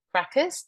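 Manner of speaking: "Crackers?" with a high sharp rise; questioning tone. Shown as a demonstration. Teacher echoes a colleague's word, rising question. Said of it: "repeating the phrase back with a questioning tone".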